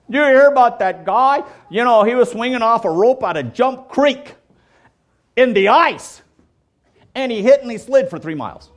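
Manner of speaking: mock excited gossiper. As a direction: play the breathless rumor-spreader.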